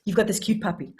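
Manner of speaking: ordinary delivery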